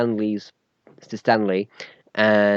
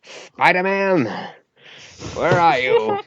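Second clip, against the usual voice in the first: high, drawn-out call in a put-on voice; Stan Lee; 7 semitones higher, slower than usual. Co-host as the comics legend phoning his superhero, high impersonation. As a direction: call out slowly in an awful celebrity impersonation. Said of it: "That was me doing my Stan Lee impression".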